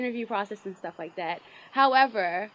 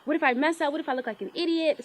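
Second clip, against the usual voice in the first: higher, louder imitated panic; mock panic; 8 semitones higher, 8 dB louder than usual. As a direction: play up your own nerves. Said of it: "And I'm like".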